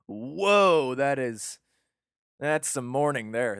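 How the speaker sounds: lightly acted, drawn-out whoa of astonishment; mock-awed bystander; onlookers' amazement. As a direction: gawk at someone else's wailing. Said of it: "just watching this going".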